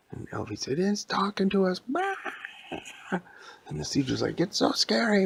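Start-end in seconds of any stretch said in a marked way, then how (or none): 0.8-1.8 high-pitched comic panic; mock-scared yelling. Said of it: "Stop yelling and screaming so loud". 4.4-5.2 high, wailing comic fright; mock-scared yelling. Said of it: "Stop yelling and screaming so loud".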